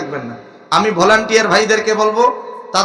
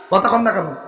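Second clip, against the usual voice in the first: ordinary talk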